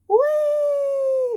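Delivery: very high, loud held whee; sliding down the hill; sledging shout of joy; gleeful whee. Acted. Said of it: "they shouted together".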